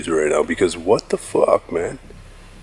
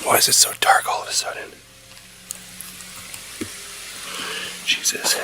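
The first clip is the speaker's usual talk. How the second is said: fast scared whisper; 7 dB quieter, faster than usual. Real, not acted. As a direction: hush it quickly, afraid. Scared in sudden darkness.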